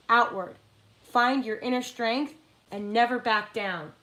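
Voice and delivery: rally them like a war speech; empowering oracle reading. Acted. Reads empowering dragon card aloud.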